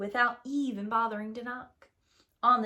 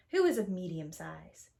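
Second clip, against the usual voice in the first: usual speaking voice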